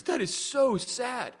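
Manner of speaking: high, drawn-out mock lament; imitated crying lady. Old folks' home lady reacts to his song, mock-tearful. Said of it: "this one lady's crying".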